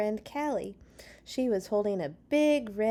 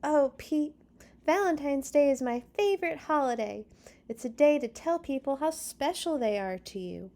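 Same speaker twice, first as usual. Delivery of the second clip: a lightly voiced girl, a bit higher and brighter; insisting Callie; 6 semitones higher, 4 dB louder than usual. A narrator's reading. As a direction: urge him, a little louder and eager. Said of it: "Callie insisted".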